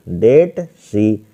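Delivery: "that सी" drawn out very slowly, word by word; slow dictation. Shown as a demonstration. Teacher dictating to students while writing, "that सी" slow and drawn out. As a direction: stretch each word of "that सी" as if students copy it down. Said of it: "तो that सी हो जाएगा यहाँ पर".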